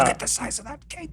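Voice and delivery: soft, breathy put-on voice; hushed awed character. Guest marvels at the host's imaginary cake, hushed mock awe. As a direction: gasp it under your breath in mock awe.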